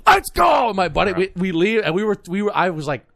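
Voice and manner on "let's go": much higher, loud victory yell; triumphant shout. Retold or imitated. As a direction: belt it out like you just won. Triumphant cheer after win.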